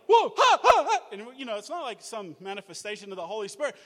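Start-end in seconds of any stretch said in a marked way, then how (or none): 0.1-1.0 very high, loud whooping laugh; excited whoop. Retold or imitated. Student whooping in class over a text message. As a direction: whoop like he just got great news. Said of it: "one of the guys is like".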